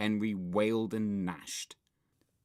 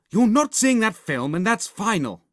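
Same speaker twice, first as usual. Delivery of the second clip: loud, high-pitched firm refusal; stern mum; 10 semitones higher, 10 dB louder than usual. A narrator's reading. Mum forbidding Henry the film, stern and loud. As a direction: lay down the law loudly.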